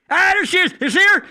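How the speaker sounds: loud, high burst of excitement; excited calling out. Real, not acted. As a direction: call out as she comes into view. Excitedly spotting Denise passing by.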